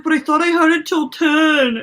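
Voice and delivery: lightly mocking high whine; whiny high guy voice. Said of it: "He's like".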